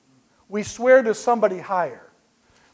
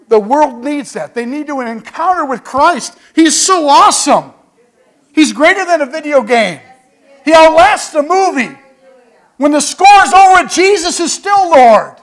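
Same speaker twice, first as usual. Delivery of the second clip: building to a loud, high preacher's cry; preaching at full force; 6 semitones higher, 7 dB louder than usual. Acted. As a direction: rise and shout it from the pulpit.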